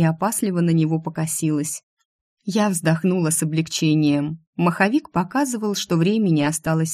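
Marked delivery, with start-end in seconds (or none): none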